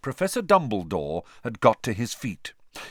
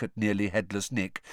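ordinary delivery

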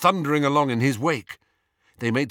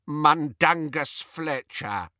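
slow, higher croaking elf voice; croaked; 4 semitones higher, slower than usual. A narrator's reading. House elf forced to name the thief to Harry, croaking. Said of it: "croaked the elf".

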